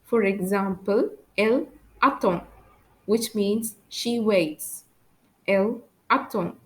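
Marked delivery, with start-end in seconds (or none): 1.4-2.4 French "attend" with a silent D. Shown as a demonstration. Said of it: "I don't pronounce the letter D". 5.5-6.5 French "attend" with a silent D. Shown as a demonstration. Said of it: "I don't pronounce the letter D".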